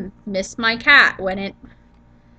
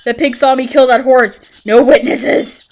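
mock villain shout; 2 semitones higher, 8 dB louder than usual. Gaming, killing a horse.

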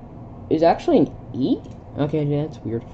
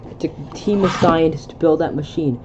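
ordinary talk, nothing marked

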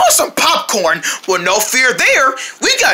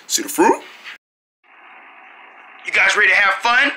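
ordinary talk

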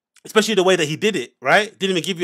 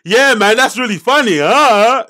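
loud, higher mock over-eager reaction; awkward forced enthusiasm; 5 semitones higher, 8 dB louder, slower than usual. Podcaster mimics host who feels awkward, forced enthusiasm. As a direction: imitate an awkward host laughing it up too loudly. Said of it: "he feels awkward. He's like".

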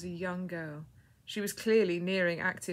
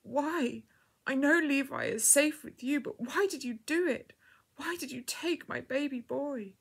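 higher-pitched grieving plea; anguished cry; 7 semitones higher than usual. A narrator's reading. Bereaved mother asking God why, anguished cry. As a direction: cry out why, pleading in grief. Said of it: "she cried out".

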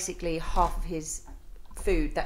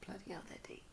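whisper; 18 dB quieter, faster than usual. Retold or imitated. Imagined onlooker's hushed comment.

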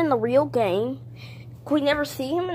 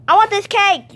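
loud and higher, grabbing for the cake; greedy shout; 7 semitones higher, 10 dB louder than usual. Acted. Toy demanding the cake from another toy, shouting. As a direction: yell that the cake is yours. Toys squabbling over cake.

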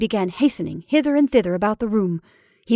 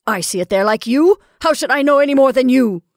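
loud, raised, impatient voice; exasperated retort; 5 semitones higher, 8 dB louder than usual. Acted. Caroline to sister about the shadow, exasperated. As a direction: refuse to explain, testily.

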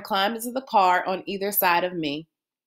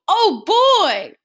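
high, loud delighted squeal; little sister squealing with joy; 12 semitones higher, 6 dB louder than usual. Acted. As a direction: squeal like a thrilled little girl. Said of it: "Joey squealed".

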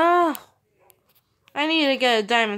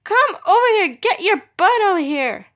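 high, loud exasperated call; 9 semitones higher, 10 dB louder than usual. Real, not acted. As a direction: yell at the stubborn cat. Calling stubborn cats.

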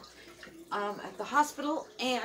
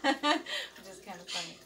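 laughing; 4 dB louder than usual. Real, not acted. Laughing at own remark.

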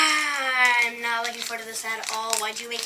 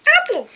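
sour yelp; 11 dB louder than usual. Real, not acted. Sour candy hits.

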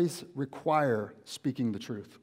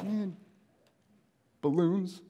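mock letdown; 4 semitones higher, slower than usual. Friend left in the desert with balloons, mock letdown. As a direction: question the useless gift in a small raised voice.

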